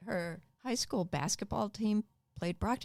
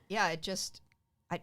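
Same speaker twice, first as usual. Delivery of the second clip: normal talking voice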